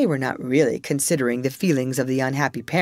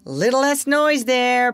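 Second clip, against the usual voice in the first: called out; 10 semitones higher, 4 dB louder than usual. Acted. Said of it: "Peter called out".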